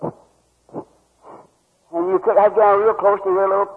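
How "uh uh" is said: imitated faint breathy cough. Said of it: "she'd go".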